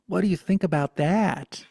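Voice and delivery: high, loud animated grandma; Italian granny. Retold or imitated. Grandmother telling grandchild about an article, high and loud. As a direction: announce it as an excited little granny. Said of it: "A little, little Italian granny Buddha".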